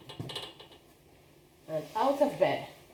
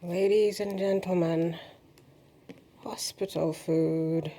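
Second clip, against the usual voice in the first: mock announcer; slower than usual. Mock presentation of her meal.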